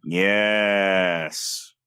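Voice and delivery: very slow, low hero voice; low, drawn-out self-serious delivery; mock Optimus pausing. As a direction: stretch every word, deep and ponderous. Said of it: "the pausing".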